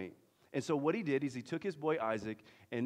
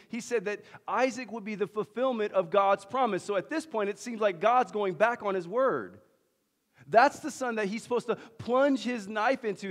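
preaching intensity; 7 semitones higher, 7 dB louder than usual. Real, not acted. Preaching at full force.

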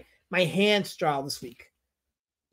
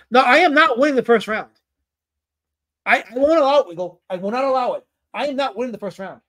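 emphatic, raised-pitch mock protest; indignant refusal; 5 semitones higher, 6 dB louder than usual. Streamer tells chat he refuses to win the first round, indignant. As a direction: put your foot down, loudly and high.

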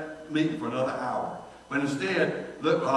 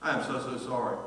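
mock apology; 4 semitones lower, 6 dB quieter than usual. Shown as a demonstration. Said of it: "When you're asking forgiveness".

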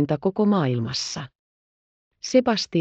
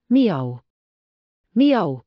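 meow. Acted. Heidi imitates kitten mew.

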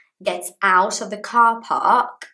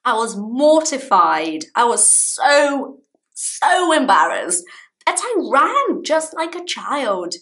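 embarrassed protest; 7 semitones higher, 5 dB louder than usual. Retold or imitated. Wife explaining to husband why she ran, high and loud. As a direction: plead your case, still mortified. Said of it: "I'm like".

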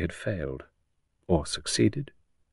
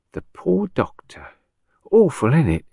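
a hint of an old Cockney woman, higher; lightly voiced old patient, higher, with relish; old gossip; 7 semitones higher than usual.

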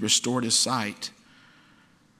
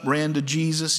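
usual speaking voice

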